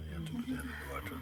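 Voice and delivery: hushed and fast, almost under the breath; quick whispered repeat. Real, not acted. Teacher whispers the instruction to the seeker, fast and hushed.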